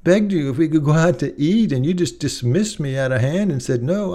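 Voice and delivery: lightly voiced wounded wife; upset wife.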